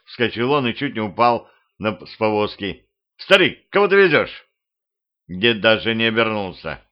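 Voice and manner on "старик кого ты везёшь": much higher and louder, panicked; alarmed shout. Acted. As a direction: demand it in a fright. Panicked question after seeing corpse. (испуганный громкий окрик)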